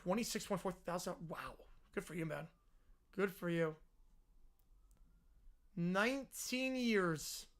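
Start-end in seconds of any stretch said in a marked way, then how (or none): none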